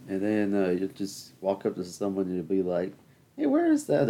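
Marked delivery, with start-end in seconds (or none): 3.4-4.1 high, sing-song call; mock friend asking. Friend arriving on the island asking for Manoli, high. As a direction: call out the question brightly.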